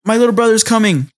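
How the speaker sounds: high, hurried mock panic; flustered. Flustered sister warning of her brother's visit, high and hurried. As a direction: blurt it high, acting flustered. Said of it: "comes home all, like, flustered".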